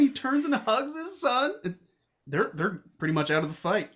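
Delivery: usual speaking voice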